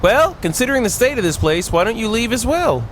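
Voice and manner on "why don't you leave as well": normal talking voice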